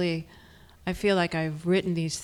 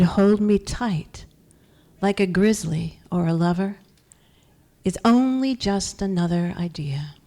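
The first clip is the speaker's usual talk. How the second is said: higher, louder poem recital, slowing at the close; poetry reading; 3 semitones higher, 6 dB louder, slower than usual. Acted. Poet reads her poem to an audience, raised recital. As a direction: declaim the poem in longer, more driving phrases.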